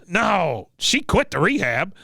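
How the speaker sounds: a hint of a stubborn old soldier; Cotton. Veteran answering about missed rehab pickup, lightly imitated dismissal. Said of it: "Cotton says".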